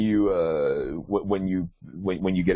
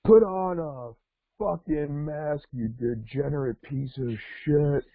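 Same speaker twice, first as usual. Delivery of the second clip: high mock yell from a stranger; shrill-free imitation of an angry stranger yelling; retold yell; 7 semitones higher, slower than usual. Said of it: "the voice yells out".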